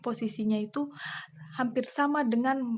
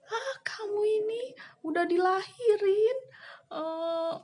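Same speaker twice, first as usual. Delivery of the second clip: crying angry mother; 11 semitones higher than usual. Retold or imitated. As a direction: wail like a hurt, furious mother. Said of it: "ibu saya, eh, marah".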